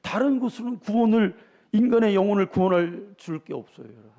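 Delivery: high, loud preacher's cry; fervent sermon delivery; full-force preaching. Acted. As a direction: drive it home to the congregation.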